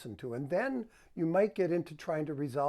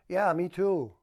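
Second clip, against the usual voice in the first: louder, flat-sounding agreement; unbelievable; 2 semitones higher, 6 dB louder, slower than usual. Shown as a demonstration. Husband answering his excited wife unconvincingly. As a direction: say it without meaning it. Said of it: "Not very believable".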